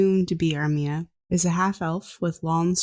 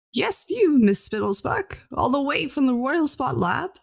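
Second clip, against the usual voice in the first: put-on sneering old scientist; 8 semitones higher than usual. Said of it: "the person in the lab coat".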